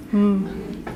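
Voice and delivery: a quiet hum in place of a number; soft hum. Shown as a demonstration. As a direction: hum instead of saying the number. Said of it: "replace our whisper with a hum".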